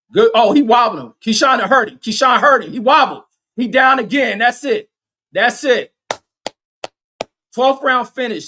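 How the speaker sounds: loud, high-pitched knockdown call; excited fight call. Real, not acted. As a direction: shout the knockdown as it happens. Calling a knockdown live.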